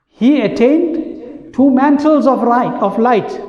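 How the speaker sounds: slow, reverent reading of the verse; solemn verse translation. Acted. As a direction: draw out each phrase with reverence.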